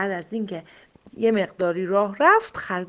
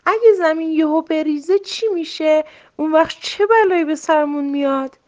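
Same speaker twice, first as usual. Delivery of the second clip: fretful worrying; 7 semitones higher, 6 dB louder than usual. A narrator's reading.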